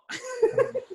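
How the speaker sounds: sudden squealing burst of laughter; high laugh. Real, not acted. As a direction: crack up at the mess. Laughing at audio chaos.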